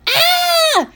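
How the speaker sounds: very high, loud "aah"; moms' piercing shriek; horrified yell. Acted. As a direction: scream in sudden mortification. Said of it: "Both moms yelled".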